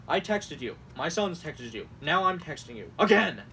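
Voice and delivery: mock indignation building to a loud "Again!"; overdramatic. Host acts out a campaign text, overdramatic. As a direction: punch each complaint, then explode. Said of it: "it's so overdramatic".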